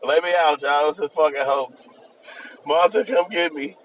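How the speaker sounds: a touch of a desperate prisoner calling out; mock plea. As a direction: beg to be let out, comically. Snoop voiced begging from jail.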